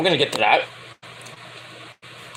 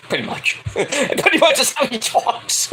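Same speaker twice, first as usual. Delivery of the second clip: talking through giggles; laughing; 6 semitones higher, 3 dB quieter than usual. Real, not acted. Host amused by own bad impression, laughing. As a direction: let the laugh break up the words.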